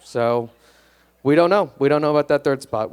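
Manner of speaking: normal talking voice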